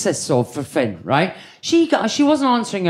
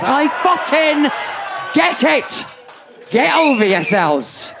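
shouted insistence; 6 semitones higher, slower than usual. Real, not acted. Comic to the crowd, shouting that she gets it.

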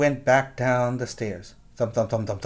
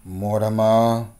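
deep policeman voice; 2 semitones lower, slower than usual. Acted. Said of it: "very deep policeman-type voices".

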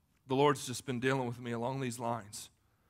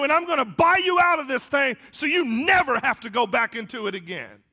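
loud, high-pitched sermon delivery; full-force preaching; 12 semitones higher, 12 dB louder than usual. Acted. Preacher to the church about being bought out of sin, full-force preaching.

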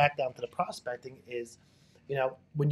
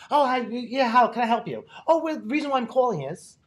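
higher, brighter matching tone; upbeat reply; 8 semitones higher, 4 dB louder than usual. Retold or imitated. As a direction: mirror the cheerful prospect. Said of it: "they're like".

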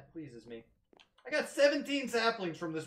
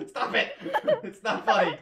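laughing protest; 9 dB louder than usual. Real, not acted.

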